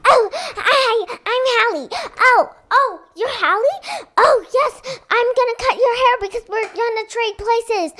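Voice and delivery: put-on high, loud, eager little girl; hurried high-pitched twin chatter; excited twin girl. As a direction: burst out the plan with glee. Twins meet, plan swap.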